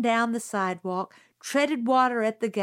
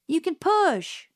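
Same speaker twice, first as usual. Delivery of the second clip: brushing it off, higher voice; a boy's offhand retort; Jim, curt; 8 semitones higher than usual. A narrator's reading. Jim waves off the narrator, curtly.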